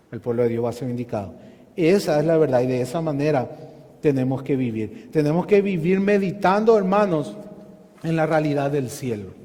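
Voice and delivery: ordinary talk, nothing marked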